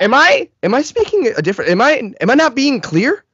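high-pitched incredulous stammer; acting out indignant exasperation; exasperated disbelief. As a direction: sputter in frustrated disbelief. Incredulous at repeated what.

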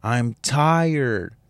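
mock exasperated plea, voice lifted; fed-up mom. Worn-out mother to her crying newborn, imitated exasperation. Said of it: "she's there and she's like".

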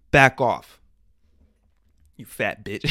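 loud, abrupt order; joking forceful telling-off; mock warning. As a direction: shout her away in pretend anger. Mock warning to absent Jada.

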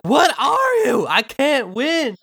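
a defeated villain shrieks in shock; imitating Ghirahim. The villain admitting defeat to the hero, high shocked wail. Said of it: "He's like".

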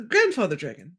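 ordinary talk

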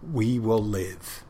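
we will live, drawn out slowly with weight on will; slow defiant vow. Retold or imitated. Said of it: "he didn't say it like that".

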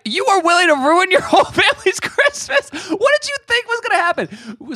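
incredulous retort. Retold or imitated. To the wife who ruined Christmas, exasperated high disbelief. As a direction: throw the question back in disbelief.

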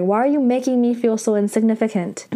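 ordinary delivery

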